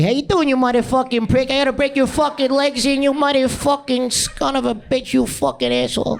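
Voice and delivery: high-pitched mock Italian American tirade; Joe Pesci. Comic mimics short, angry Italian men, high hammed-up rant. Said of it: "They sound more like Joe Pesci".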